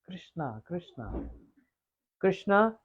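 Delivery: soft, tender repeated name; lightly voiced parrot calling softly; parrot imitating Radharani. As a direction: call his name gently, fading. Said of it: "saw parrot is speaking".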